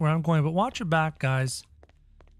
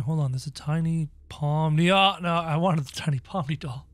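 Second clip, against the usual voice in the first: usual speaking voice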